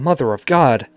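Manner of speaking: panicked. A narrator's reading. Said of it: "Gordon panics".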